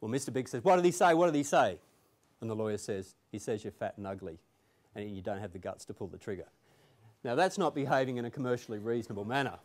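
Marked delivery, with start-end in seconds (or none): 0.6-1.8 loud, insistent repeated question; urgent demand. Retold or imitated. Mister Big with gun drawn presses the lawyer, loud and impatient. Gangster at gunpoint demands translation. 3.3-6.5 low, soft and matter-of-fact; deadpan punchline. Retold or imitated. Lawyer falsely interprets for Mister Big, deadpan and quiet.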